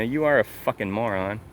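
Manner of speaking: ordinary talk, nothing marked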